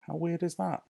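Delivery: ordinary talk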